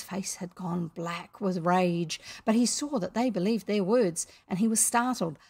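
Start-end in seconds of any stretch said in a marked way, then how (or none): none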